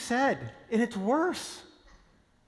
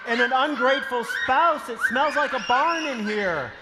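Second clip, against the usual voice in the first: loud, high, laughing frenzy; frantic shout; 5 semitones higher, 5 dB louder than usual. Acted. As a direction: shout it over the chaos. House overrun, shouting.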